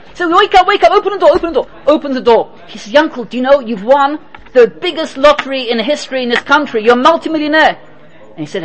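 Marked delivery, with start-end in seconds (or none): 0.3-1.7 frantic urging. Acted. Friend begging Yankel to open up, frantic high urging. 2.9-7.8 higher, thrilled announcement of the win; excited news. Acted. Friend telling Yankel he won the lottery, excited.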